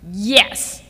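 put-on a betrayed kid spitting it out; sharp hurt little-girl yes; spat out. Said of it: "she spat out".

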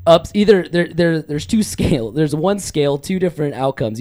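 ordinary talk, nothing marked

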